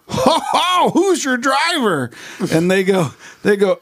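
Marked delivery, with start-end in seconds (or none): 0.1-2.1 much higher, slow, put-on improv voice; hammed-up character voice. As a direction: ham it up as a goofy passenger climbing into the car. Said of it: "I get in, I go".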